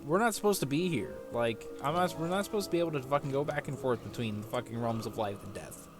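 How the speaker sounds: ordinary talk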